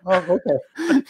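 slow, drawn-out okay through a laugh; amused, slow mock agreement; laughing sarcastic okay. Sarcastic reply to dismissive dad.

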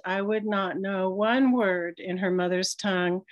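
usual speaking voice

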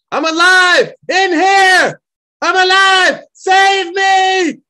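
trapped man shouting to rescuers, full force. Acted. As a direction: scream it so rescuers hear you. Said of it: "able to shout louder".

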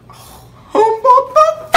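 high, loud whoop of delight; hammed-up thrilled yelp; excited squeal. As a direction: shriek it like you just won. Invited to the back seat.

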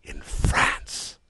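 aghast exclamation; breath-heavy cry of disbelief; cried out. A narrator's reading. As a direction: gasp it out in alarm. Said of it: "he cried".

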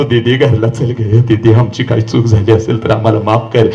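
low, subdued plea of a father; humble, low-voiced appeal; pleading father. Father begging his daughter, retold.

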